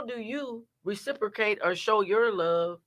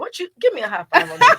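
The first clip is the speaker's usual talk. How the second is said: higher, louder, laughing with delight; excited laugh; 8 semitones higher, 5 dB louder than usual. Real, not acted. Host laughing excitedly at the guest's story. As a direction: cheer her on through laughter.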